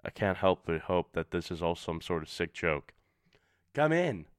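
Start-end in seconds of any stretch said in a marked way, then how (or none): 3.7-4.3 high, louder call through the door. A narrator's reading. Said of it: "my roommate yells".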